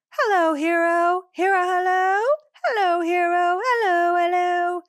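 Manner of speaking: high, loud mock singer voice; high-pitched imitation of the song's greeting; imitating the singer. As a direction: belt it out high like the track's vocalist. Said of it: "this is the one that's like".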